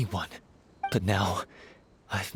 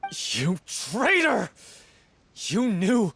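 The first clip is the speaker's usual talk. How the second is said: raised, furious and betrayed; angry accusation; 6 semitones higher than usual. Acted. Betrayed lover accusing Viamant, furious. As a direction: hurl it at him like a blow.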